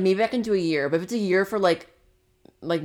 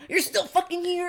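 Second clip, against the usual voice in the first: sharp, high, incredulous snap; snapping at him; 13 semitones higher than usual. Retold or imitated. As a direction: snap like a fed-up mom. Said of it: "my mom snaps".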